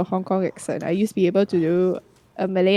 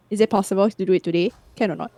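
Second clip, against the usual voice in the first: Singlish; 2 semitones lower, much faster than usual. Shown as a demonstration. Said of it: "That's already Singlish".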